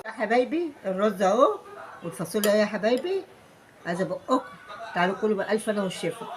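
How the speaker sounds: soft, affectionate call to come eat. Real, not acted. Calling family to eat food.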